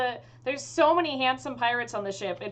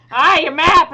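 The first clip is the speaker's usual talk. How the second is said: much louder, higher pirate exclamation; hearty pirate cry; 6 semitones higher, 16 dB louder than usual. Acted. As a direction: bellow it like a pirate captain. GM voices excited pirate captain.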